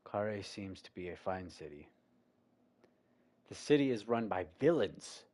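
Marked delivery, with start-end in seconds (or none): 3.5-5.2 loud declaration. Real, not acted. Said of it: "I'm gonna stir the pot".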